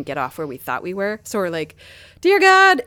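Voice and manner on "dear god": much higher and louder cry of dismay; mock panic at the metro map; panicked exclamation. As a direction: blurt it out like you're suddenly lost. Panicking over changed metro stops.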